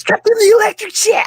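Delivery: high, loud metal scream; screamed lyric. Retold or imitated. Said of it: "he just screams it".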